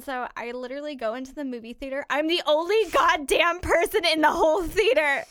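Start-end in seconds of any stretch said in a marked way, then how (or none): 2.1-5.2 loud, high disbelief; louder, higher exasperated complaint. Real, not acted. Alone in cinema, exasperated.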